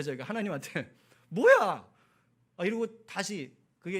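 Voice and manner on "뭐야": loud, high-pitched burst of pique; mock-offended outburst; sulky retort. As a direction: snap it out in a big indignant huff. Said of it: "그리고 삐졌어요".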